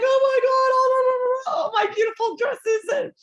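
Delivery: high, loud mock wail over stolen dresses; mock wailing friend. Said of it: "she's like".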